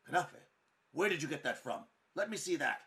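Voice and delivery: loud, high, suspicious outburst; snatch at the news, alarmed; startled outburst. Acted. Older baker startled by the rival's kanafe, loud and high.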